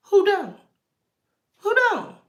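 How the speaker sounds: rising emphasis. Real, not acted. Rhetorical question repeated.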